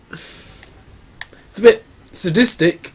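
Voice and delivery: normal talking voice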